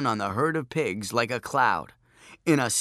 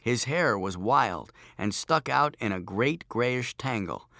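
ordinary delivery

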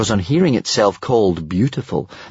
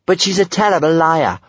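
higher, lively caution; warning; 8 semitones higher, 4 dB louder than usual. A narrator's reading. Kamikaze warns about the dragon's lying, higher-pitched. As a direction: warn them off cheekily. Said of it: "warned Kamikaze".

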